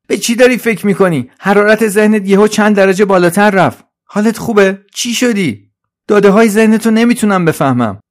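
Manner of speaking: high, loud, urgent helper voice; Trolley, alarmed. Acted. Trolley notices Suzi's mind overheating, high alarmed voice. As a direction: fire off worried questions, high and loud.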